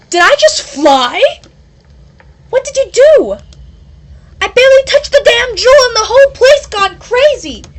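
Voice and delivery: high, loud panicked yell; high loud frightened outburst; alarmed shout. Acted. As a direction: shout at her in shock. Panic after flying.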